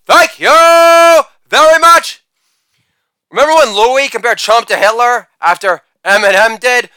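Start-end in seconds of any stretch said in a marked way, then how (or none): 0.1-2.2 high, slow, drawn-out thank-you bellowed to the room; milk the big finish like a lounge crooner; hammed-up stage thank-you shouted; shouted showman sign-off.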